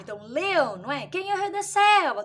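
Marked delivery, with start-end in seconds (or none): 1.1-2.2 higher-pitched classroom quiz question; teacher asking a riddle. Acted. Teacher quizzing a class on an animal clue, lightly pitched-up riddle voice. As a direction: put the riddle to young children, pitched up.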